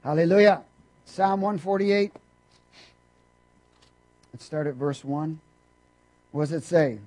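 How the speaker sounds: normal talking voice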